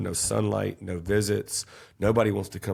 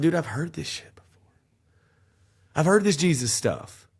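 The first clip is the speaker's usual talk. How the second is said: higher, half-whispered, scoffing at the pastor; dismissive brush-off; 10 semitones higher, 5 dB louder than usual. Retold or imitated. Telling the host how he scoffed at the pastor, high mock brush-off. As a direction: mimic his cocky younger self brushing off church. Mocking his past dismissive self.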